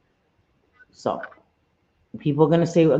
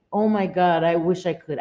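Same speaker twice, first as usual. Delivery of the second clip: ordinary delivery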